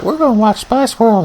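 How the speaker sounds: lightly voiced young girl; bratty preteen girl. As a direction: mimic the bossy cousin. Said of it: "she was like".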